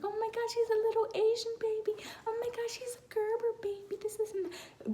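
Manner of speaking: high, mock-gushing baby talk; imitating cooing relatives, high and breathy; gushing onlookers. Everyone gushing over newborn.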